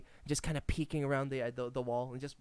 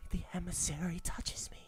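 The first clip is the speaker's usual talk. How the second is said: breathless whispered rat voice; awed whisper; 7 dB quieter than usual. Acted. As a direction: whisper it in awe. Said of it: "he gawks at you, goes".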